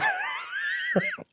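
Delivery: high shrieking laugh. Real, not acted. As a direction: burst out in a shriek. Laughs while telling story.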